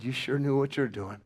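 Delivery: normal talking voice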